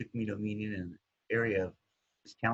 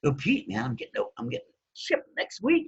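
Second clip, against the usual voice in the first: eager newcomer; 12 semitones higher than usual. Retold or imitated. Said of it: "talking to my sponsor".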